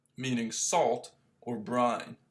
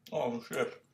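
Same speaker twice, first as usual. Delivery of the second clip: normal talking voice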